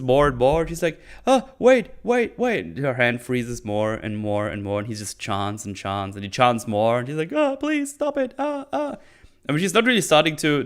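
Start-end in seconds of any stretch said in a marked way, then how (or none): 1.3-2.8 higher, hurried alarmed pleading; mock alarm. Nori begging the chant to stop, mock alarmed. 7.3-9.0 much higher, hammed-up pleading whimper; mock pleading. Nori begging Meteor Man to stop, mock whimpering.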